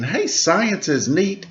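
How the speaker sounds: plain reading voice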